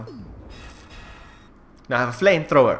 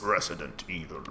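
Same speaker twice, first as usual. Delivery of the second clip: deep announcer voice; 8 semitones lower, 7 dB quieter than usual. Retold or imitated. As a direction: growl the title like the game. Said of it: "reminds me of that voice".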